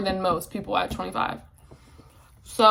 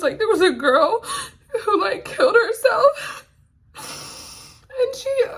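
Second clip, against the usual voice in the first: high, breaking sobbing voice; crying; 10 semitones higher, slower than usual. Real, not acted. Telling viewers about a young mother's suicide, sobbing. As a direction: fight through the tears to get it out.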